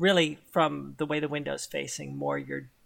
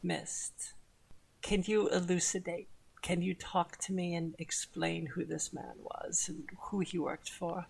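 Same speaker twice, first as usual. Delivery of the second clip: whispered, much softer coaxing; hushed coaxing; 7 dB quieter than usual. Acted. Celine pressing Mist for secrets, hushed whisper. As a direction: coax it out of him in a whisper. Villain softly questions Mist.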